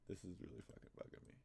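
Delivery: fast, whispered irritation; annoyed whisper. Real, not acted. Streamer whispering his annoyance under his breath. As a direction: hiss it under your breath.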